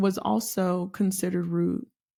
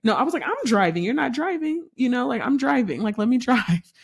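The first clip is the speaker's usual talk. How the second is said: annoyed retort; 4 semitones higher than usual. Retold or imitated. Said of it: "So I said it like that?".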